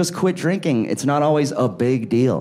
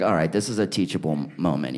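much lower, faster, mock fatherly tone; mock serious; 10 semitones lower, 6 dB quieter, faster than usual. Uncle decides to teach his niece, lower and mock serious. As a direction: switch into lecture mode. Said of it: "I was like".

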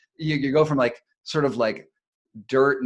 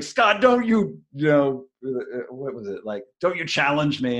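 retold yell; 2 semitones higher than usual. Leader yelling down the mountain at Scott, hammed-up angry yell. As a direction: replay him barking a challenge down the slope. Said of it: "as he's yelling down".

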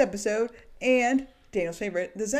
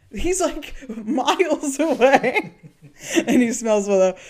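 laughing; 5 semitones higher, 9 dB louder, much slower than usual. Real, not acted. Laughing about Oz.

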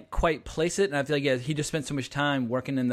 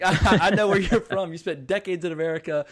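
louder, higher retold scolding with a laugh; mock outrage; 4 semitones higher, 5 dB louder than usual. Host calling out cousin's accent, mock outrage. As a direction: raise the voice in mock indignation.

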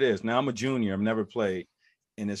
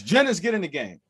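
loud, high sideline yell; retold shout from a pissed coach; coach's scream; 8 semitones higher, 7 dB louder than usual. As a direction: bellow an order like an angry coach. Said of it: "Coach just screams".

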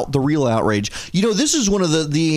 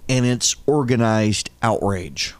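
ordinary talk

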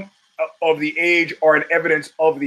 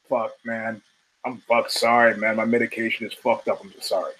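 mumbled apology; 6 semitones lower, 4 dB quieter than usual. Retold or imitated. Commenter apologizing, embarrassed.

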